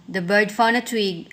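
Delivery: usual speaking voice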